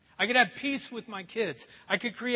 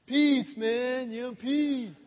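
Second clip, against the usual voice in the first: preacher mocking political peace, hippie style; 3 semitones higher, 4 dB louder, much slower than usual. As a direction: send up a sixties peace-sign hippie. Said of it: "not talking about political peace".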